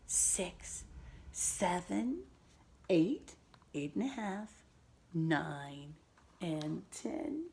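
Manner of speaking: slow, soft counting aloud; teaching a child to count. Shown as a demonstration. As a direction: count along with the child gently. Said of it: "Can you count with me?".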